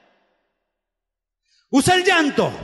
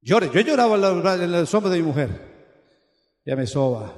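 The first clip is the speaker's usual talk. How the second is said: normal talking voice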